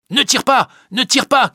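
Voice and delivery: high, loud urgent shout. Acted. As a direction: yell to stop the shot. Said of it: "crie Briand". (cri pressant, aigu et fort)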